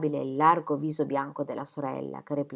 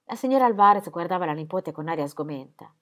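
ordinary delivery, nothing marked

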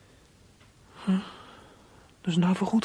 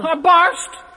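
angry outburst; 8 semitones higher, 6 dB louder than usual. Acted. Boy snaps at family.

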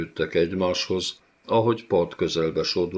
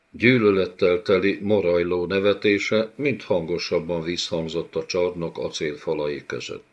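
ordinary delivery

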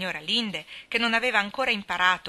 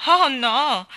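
a high, loud flustered "Oh no!"; cry out; 7 semitones higher, 11 dB louder than usual. A narrator's reading. Anna, blushing at the stranger's question, cries out. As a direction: blurt it out in alarm, much louder. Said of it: "gridò Anna".

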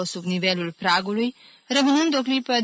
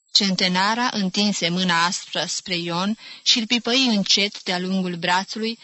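ordinary delivery

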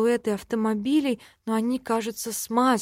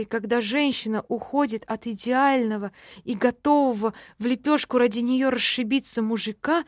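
ordinary delivery

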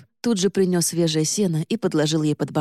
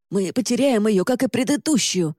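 a rasped, higher alarmed cry; hoarse; 5 semitones higher than usual. A narrator's reading. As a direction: croak it out in alarm. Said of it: "прохрипел Джозеф".

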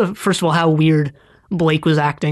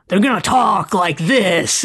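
bad French accent; 3 semitones higher, slower than usual. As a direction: do an American's clumsy take on French. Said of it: "a stereotypical French accent".

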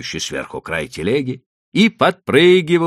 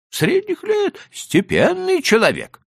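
much higher, thin, meek trader's voice; high, pleading old merchant; 5 dB louder than usual. A narrator's reading. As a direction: play the cautious Leiba, pitched way up. Leiba answers.

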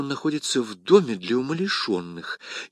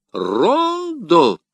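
high contemptuous scoff; the Jesuit scoffing at the poem, high and loud; scornful; 7 semitones higher, 4 dB louder than usual. A narrator's reading. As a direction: spit the word in disdain. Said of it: "презрительно произнёс иезуит".

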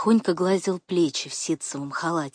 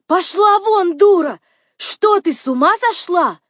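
loud, high, outraged order; furious full-voiced rebuke; angry shout; 11 semitones higher, 9 dB louder than usual. A narrator's reading. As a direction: yell at her to get out. Гриша прогоняет Варвару.

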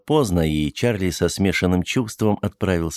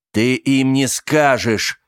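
firm slow insistence; 6 semitones higher, 4 dB louder, slower than usual. Acted. Charlie insists more firmly.

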